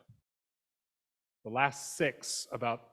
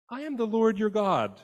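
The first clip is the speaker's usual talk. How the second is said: a raised, declaring God voice; 9 semitones higher, 6 dB louder than usual. Retold or imitated. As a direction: proclaim it higher, like God introducing himself. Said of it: "God starts with an I statement".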